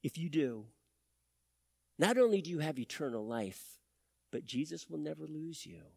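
quiet, intimate sermon ending; soft altar call. Acted. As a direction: gently invite the church to trust. Soft closing appeal.

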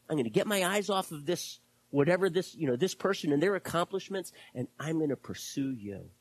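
ordinary talk

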